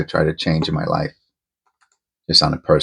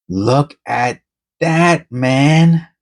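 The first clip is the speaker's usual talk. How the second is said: excited look; 7 semitones higher, much slower than usual. Real, not acted. Showing a coin.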